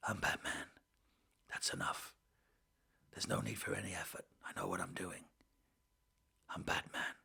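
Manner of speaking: soft low Batman whisper; Keaton whisper. Retold or imitated. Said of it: "Keaton taking it easy. Just a whisper".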